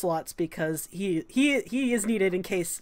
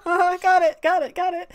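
high, hurried-to-agree character voice; laughing, high-pitched yes-man; eager acknowledgement; 9 semitones higher, 6 dB louder than usual. Acted. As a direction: pipe up with eager little agreements. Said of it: "So I was like".

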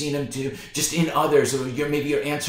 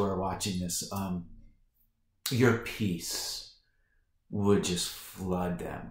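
soft, earnest prayer voice, drifting lower; hushed prayer; 4 semitones lower, 3 dB quieter than usual. Acted. Pastor praying over his morning viewers, hushed prayer. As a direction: pray quietly, as if in the room with God.

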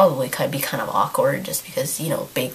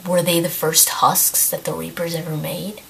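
ordinary talk

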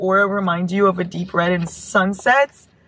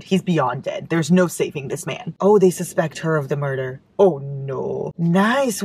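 usual speaking voice

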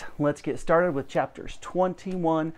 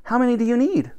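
put-on higher girl's voice; 10 semitones higher, faster than usual. Girl asks the professor.

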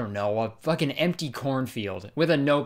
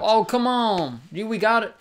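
put-on pleading boyfriend; 6 semitones higher than usual. Acted boyfriend begging in skit.

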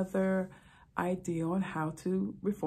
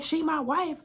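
insistent husband; 10 semitones higher, 4 dB louder than usual. Retold or imitated.

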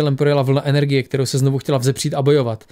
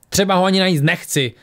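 a higher, pushed cry; shout; 5 semitones higher than usual. Acted. Tora shouting back at Lisbeth, defiant cry. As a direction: fling it out in defiance. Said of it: "křikla Tora".

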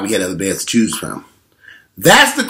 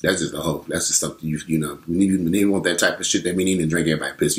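lower, softer, casual bragging voice; nonchalant guy; 6 semitones lower, 6 dB quieter than usual. Retold or imitated. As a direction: drop low and shrug it off like it's nothing. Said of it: "He said".

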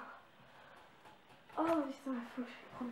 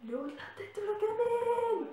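high, strange put-on voice; weird voice; 10 semitones higher than usual. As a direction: squeal the line in a creepy voice. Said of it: "je prends une voix très bizarre".

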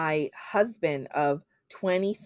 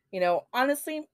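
normal talking voice

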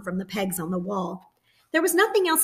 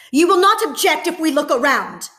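harsh, much louder and higher soldier; 8 semitones higher, 8 dB louder than usual. A narrator's reading. Soldier insisting on searching the flat, harsh and loud. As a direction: bark it as an order, not a question. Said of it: "The voice was harsh".

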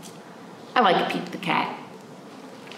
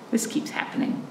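hushed aside; whispered aside; 7 dB quieter than usual. Acted. Reader whispering an aside to the children. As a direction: whisper it like a secret.

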